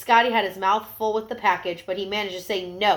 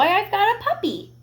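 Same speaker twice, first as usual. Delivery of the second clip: put-on much higher, happy child's voice; proud little boy; 13 semitones higher, 5 dB louder than usual. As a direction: announce your puppy proudly.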